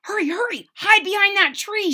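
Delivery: high, loud urgent call; urgent shout. A narrator's reading. Charles urges Wilbur to hide from the dog, shouting. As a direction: rush him to hide. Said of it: "shouted Charles".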